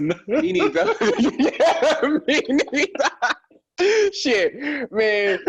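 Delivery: high laughing, drawn out. Real, not acted. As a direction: laugh through the words. Laughing admission he couldn't swim.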